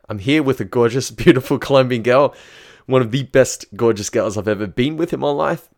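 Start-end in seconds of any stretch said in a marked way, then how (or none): none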